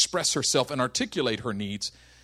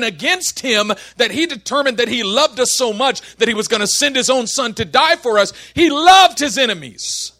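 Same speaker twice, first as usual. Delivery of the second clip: loud, rising pulpit delivery; full force preaching; 8 semitones higher, 10 dB louder than usual. Acted. Pastor driving home God's love, loud and rising. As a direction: preach it to the back row.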